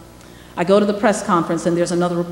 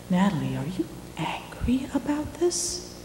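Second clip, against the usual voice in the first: whispered, slow and much softer; hushed suspicious question; 11 dB quieter than usual. Retold or imitated. Sunday editor asks quietly.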